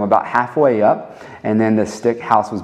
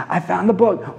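raised-pitch exclamation of a find; excited discovery; 6 semitones higher than usual. Acted. Preacher imagines spotting the Bible on his nightstand, excited. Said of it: "give me that kind of excitement".

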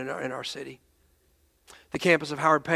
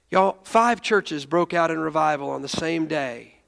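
ordinary talk

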